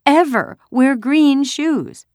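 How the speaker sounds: put-on high, loud, drawn-out child's protest; wailing refusal. A narrator's reading. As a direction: wail it slowly like a stubborn little girl. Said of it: "Kirsty wailed".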